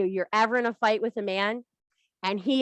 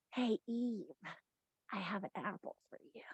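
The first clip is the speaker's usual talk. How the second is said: Lilith tempting; 17 dB quieter than usual. Acted. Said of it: "she's like".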